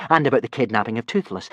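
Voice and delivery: usual speaking voice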